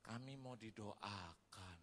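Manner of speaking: slow, soft plea; soft slow request; humble request. Retold or imitated. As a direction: ask shyly and slowly for prayer. Guest asks for prayer.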